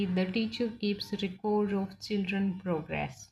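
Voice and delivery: the noun example read out very slowly; slow example sentence. Shown as a demonstration. Teacher dictates the noun example to the class. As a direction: dictate each word slowly. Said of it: "noun की तरह use करेंगे".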